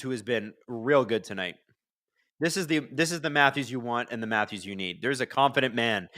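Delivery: ordinary delivery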